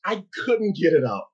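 usual speaking voice

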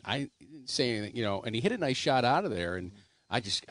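ordinary talk, nothing marked

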